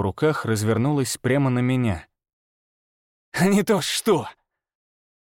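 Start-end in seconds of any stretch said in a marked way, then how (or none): 3.3-4.3 through gritted teeth. Acted. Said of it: "процедил он сквозь зубы".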